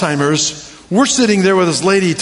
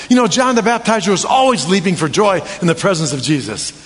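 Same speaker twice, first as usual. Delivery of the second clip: ordinary delivery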